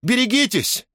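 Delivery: very high-pitched warning cry; sudden cry. A narrator's reading. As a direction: cry out to warn of danger. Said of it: "крикнула вдруг Лиана".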